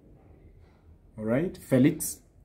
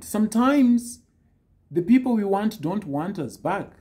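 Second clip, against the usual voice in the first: ordinary delivery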